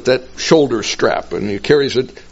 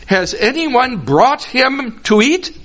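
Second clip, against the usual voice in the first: puzzled question; 9 semitones higher, slower than usual. Acted. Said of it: "Now they're puzzled".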